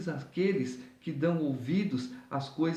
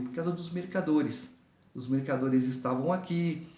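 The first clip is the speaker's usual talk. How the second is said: normal talking voice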